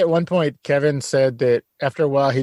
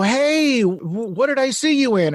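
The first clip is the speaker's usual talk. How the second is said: eager fan voice; 9 semitones higher than usual. Retold or imitated. Fan in an airport stopping Kevin, eager and higher. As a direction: pop up like a fan who half-recognises him. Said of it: "they would go up and go".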